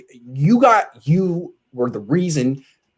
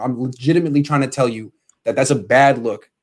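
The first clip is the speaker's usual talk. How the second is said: normal talking voice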